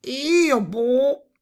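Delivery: high, loud toad croak; big toad. A narrator's reading. Toad answering the princess who asked who spoke, croaky toad voice. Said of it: "rispose un grosso rospo".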